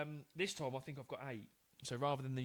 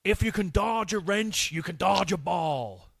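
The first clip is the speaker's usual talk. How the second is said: film quote impression; 3 semitones higher, 12 dB louder than usual. Shown as a demonstration. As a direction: bellow it like a coach at drills. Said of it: "do the voice as best I can".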